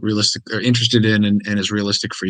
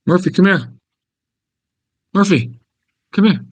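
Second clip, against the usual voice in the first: high, loud call to the dog; 7 semitones higher, 5 dB louder than usual. Real, not acted. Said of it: "It's my dog voice".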